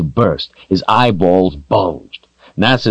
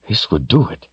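ordinary delivery, nothing marked

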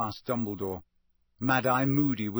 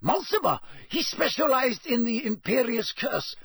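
anxious informer; 11 semitones higher than usual. A narrator's reading. Karkaroff naming names to the court, anxious. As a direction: blurt the names in a high, strained voice. Acted prisoner in narration.

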